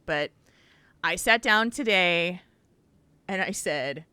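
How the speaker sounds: usual speaking voice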